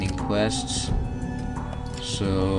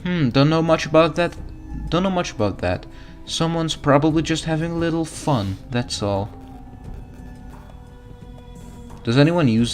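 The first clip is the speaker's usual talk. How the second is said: higher, cheerful mom voice; motherly diner cook; 6 semitones higher than usual. Acted. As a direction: dote on the hungry customer. Mom NPC speaks.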